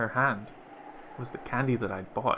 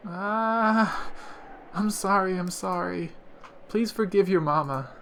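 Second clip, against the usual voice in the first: crying apology; 5 semitones higher than usual. Acted. Rosa begging Maria's forgiveness, high and tearful. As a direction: beg your daughter's forgiveness through tears. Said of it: "crying and begging forgiveness".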